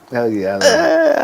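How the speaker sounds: high, laughing, excited answer; real delight. Real, not acted. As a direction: gush about loving it. Host notes he enjoys it.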